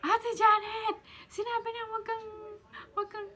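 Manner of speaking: high, loud mock nagging voice; shrill imitation of a scolding big sister; mock scolding friend. Imitating Sis Mabi scolding her.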